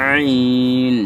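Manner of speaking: আইন pressed from the throat; throaty Arabic ain. Shown as a demonstration. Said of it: "আইন, pressure দিতে হবে".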